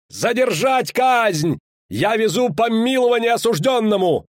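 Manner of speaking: high, calling proclamation; urgent shout. A narrator's reading. Rider bringing a pardon to the scaffold, shouting. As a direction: call it out high across a crowd. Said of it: "крикнул наездник".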